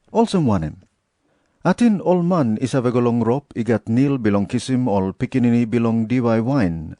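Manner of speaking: ordinary delivery, nothing marked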